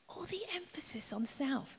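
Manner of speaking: breathy whisper, no voice. Real, not acted. Preacher whispers the emphasis on self. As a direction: breathe it out like a secret.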